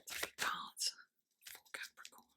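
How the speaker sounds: barely audible, voiceless whisper; whispered request. Real, not acted. Tarot reader asking the deck for cards, whispered. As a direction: whisper it to the deck, fading away.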